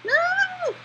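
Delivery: sudden high yelp of alarm; startled cry. Real, not acted. Player yelping as the monster appears. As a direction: yelp as the scare hits.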